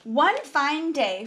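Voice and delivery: plain reading voice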